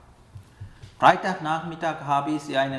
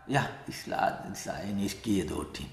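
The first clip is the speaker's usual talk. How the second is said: much lower and softer, a casual throwaway agreement; mock careless yes; 8 semitones lower, 8 dB quieter than usual. Helper mimics a careless yes to an invitation, low and offhand. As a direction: toss it off lightly as the wrong attitude.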